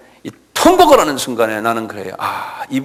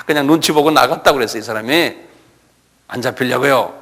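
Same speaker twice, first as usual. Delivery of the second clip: normal talking voice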